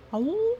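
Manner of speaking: a short high wolf howl; brief mock howl. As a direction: toss off a quick playful howl. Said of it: "You spend a lot of time howling".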